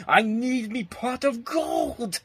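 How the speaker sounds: high, slow leprechaun scream. Retold or imitated. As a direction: drag it out like a greedy leprechaun. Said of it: "whenever you hear him scream out".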